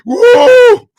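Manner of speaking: very high, loud whoop; big comic "whoa" swooping up; mock whoa. Host likening self to Magneto, high whoop. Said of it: "I'm like Magneto about this point".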